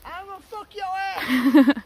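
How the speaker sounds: slow, high, loud mock roar; mock wild man shout. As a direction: bellow it like a crazed hillbilly.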